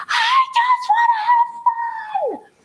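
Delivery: put-on very high, strained child's wail; high-pitched screaming-kid imitation. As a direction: squeal it out like a kid mid-tantrum. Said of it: "he was screaming and crying".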